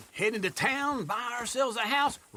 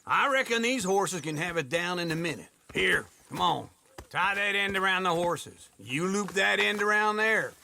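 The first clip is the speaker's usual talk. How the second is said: ordinary delivery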